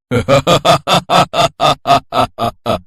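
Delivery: long staged demon laughter; villainous laugh. Acted. Demon laughs off Durga's threat, villainous and mocking. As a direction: laugh in even, mocking bursts that trail away.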